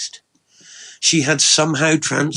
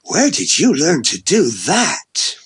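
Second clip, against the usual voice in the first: slow, swooping, louder and higher question; amazed; 4 semitones higher, 5 dB louder than usual. Acted. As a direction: draw it out in delighted astonishment. Amazed after a first kiss.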